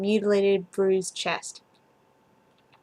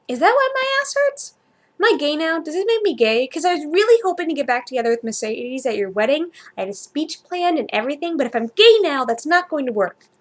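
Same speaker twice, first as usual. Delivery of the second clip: high, anxious and rushed; panicked; 5 semitones higher, 3 dB louder than usual. Acted. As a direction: spiral into worried panic.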